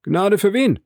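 high, loud demanding cry; alarmed cry. Acted. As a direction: shout it up high, startled out of stupor. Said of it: "rief er". (hoch und laut, aufgeschreckt gerufen)

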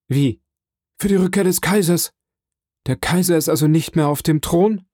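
high, incredulous questioning; taken aback, pitch jumping up; astonished echo. A narrator's reading. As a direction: repeat the news as a startled question. Unseen prisoner astonished by the news.

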